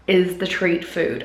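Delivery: ordinary talk, nothing marked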